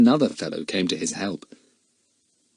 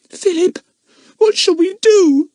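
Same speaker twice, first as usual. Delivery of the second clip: put-on distressed girl; 7 dB louder than usual. A narrator's reading. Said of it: "scarlet with rage".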